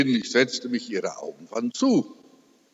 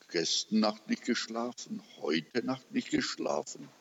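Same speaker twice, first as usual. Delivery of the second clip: much softer, a hushed murmur; hushed worry; 9 dB quieter than usual. Retold or imitated. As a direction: murmur it in gentle concern. Daughter points to sleepless nights.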